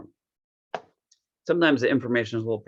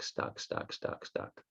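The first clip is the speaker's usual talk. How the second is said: each stuck whispered softer, trailing away; fading to a whisper; 13 dB quieter, much slower than usual. Retold or imitated. Young man retelling his stuck bond with mom, whispered. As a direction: let the word die into a breath.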